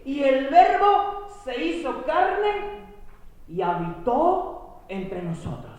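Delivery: high, slow and reverent recitation; solemn scripture reading. Acted. Preacher reciting the verse to the congregation, high and slow. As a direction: lift the verse up slowly.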